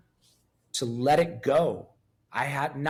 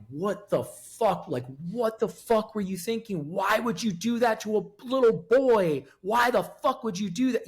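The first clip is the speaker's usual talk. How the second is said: retold outrage, pitched higher; angry confrontation; 6 semitones higher than usual. Confronting his childhood abuser in a vision, angry and higher. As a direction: demand an answer, voice climbing as it goes. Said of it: "I was so angry".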